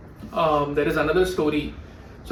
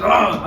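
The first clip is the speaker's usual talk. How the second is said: sudden loud mock monster roar; 3 semitones higher, 5 dB louder than usual. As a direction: roar out a short, scary "Ah". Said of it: "the monster goes".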